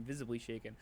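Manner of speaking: normal talking voice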